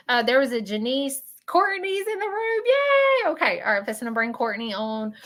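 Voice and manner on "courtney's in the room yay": high-pitched cheer rising into "yay"; excited welcome. Real, not acted. Host spotting the next guest in the room, excited welcome. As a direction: cheer the guest's arrival, voice sailing up.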